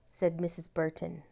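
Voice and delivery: usual speaking voice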